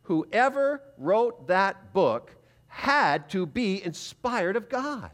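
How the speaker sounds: very slow, reverent, each word weighed; awed realization. Retold or imitated. Preacher recalls closing the book, slow and reverent. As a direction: let it dawn on you slowly, in awe. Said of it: "I put the book down and I said to myself".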